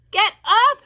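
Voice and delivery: high, very loud scream. A narrator's reading. Wilbur screaming at the lazy rat, high and loud. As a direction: scream at him to move. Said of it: "screamed Wilbur".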